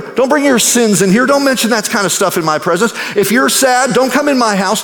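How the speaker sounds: a hammed-up stern bouncer shooing sinners off; mock scolding gatekeeper. Preacher mimics a God who bars sinners, mock scolding. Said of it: "God doesn't say".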